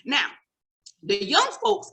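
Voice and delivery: ordinary delivery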